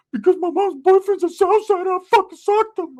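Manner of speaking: put-on high, loud whimpering teen voice; crying cousin voice. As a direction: wail it like a scared kid cousin. Crying cousin Ricky talking.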